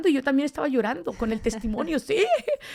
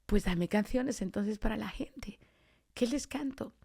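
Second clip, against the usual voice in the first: slow, half-whispered plea; hushed prayer; 6 dB quieter, slower than usual. Retold or imitated. She asks God for songs for the people, in hushed prayer. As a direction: murmur a retold request to God. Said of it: "siempre en oración digo, "Dios".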